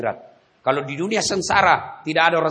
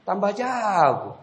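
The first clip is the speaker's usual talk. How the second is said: amazed angel; 6 semitones higher than usual. Acted. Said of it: "Malaikat mungkar tambah geleng kepala".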